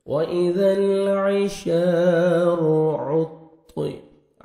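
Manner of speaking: slow melodic tajweed chant; teacher reciting the verse for students to follow; Quran recitation. Acted. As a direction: recite slowly, drawing out each vowel.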